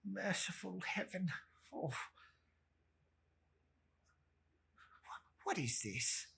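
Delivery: hushed, trembling dread; frightened Scrooge. Acted. Scrooge to the Spirit, hushed and afraid. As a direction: whisper your horror to the Spirit.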